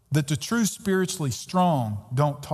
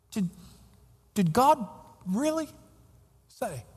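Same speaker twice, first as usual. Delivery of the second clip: sly tempting voice, high then dropping away; a hint of the serpent's sly question; devil's whisper; 7 semitones higher than usual. Devil whispering in our ear.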